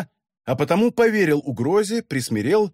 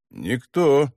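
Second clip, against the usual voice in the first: bored indifference; 2 semitones lower than usual. Acted. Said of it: "равнодушно, даже как бы скучая".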